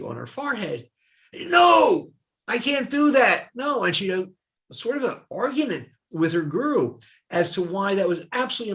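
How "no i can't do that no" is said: high, loud shouted no; retold refusal. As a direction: refuse it in a shocked outburst. Swami refuses swastika at initiation.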